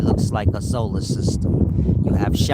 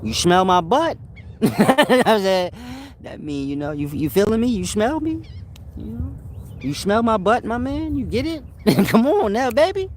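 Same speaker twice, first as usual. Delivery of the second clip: higher-pitched put-on old-timer voice, teasing the listeners; mock old man hustler, much higher voice; 13 semitones higher than usual. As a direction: play an old street-wise elder, pitched up and playful. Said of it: "That's my old man voice".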